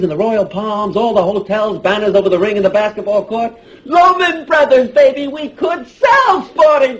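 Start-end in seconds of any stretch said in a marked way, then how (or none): 3.8-7.0 high, exuberant sales cry; slow it down and belt out the big idea; excited pitch. Acted. Brother pitching his business idea.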